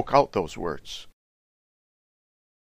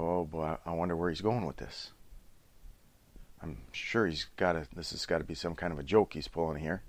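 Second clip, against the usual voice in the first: much softer, wry inner thought; 7 semitones lower, 9 dB quieter than usual. Retold or imitated. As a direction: think it to yourself, quietly amused.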